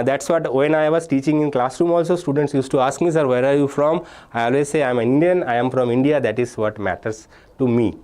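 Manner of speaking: normal talking voice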